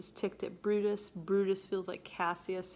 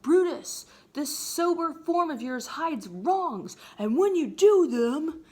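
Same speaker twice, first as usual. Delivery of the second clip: louder and higher, slow accusing; angry Cassius; 7 semitones higher, 8 dB louder, slower than usual. Retold or imitated. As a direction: fling the charge at him. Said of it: "And then Cassius is like".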